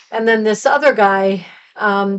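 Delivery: ordinary delivery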